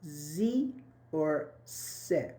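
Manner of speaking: Z and se drawn out slowly; contrasting sounds. Shown as a demonstration. Teacher asks class which sound, "Z" and "se" slowly drawn out. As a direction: stretch the buzz of "Z" against the hiss of "se". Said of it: "Which one?".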